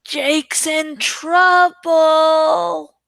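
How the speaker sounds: high, drawn-out singsong tease; teasing loud whisper. A narrator's reading. Marco teasing Jake in front of everyone, slow singsong whisper. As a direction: stretch it out slowly like a gleeful taunt. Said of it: "Marco said in a loud whisper".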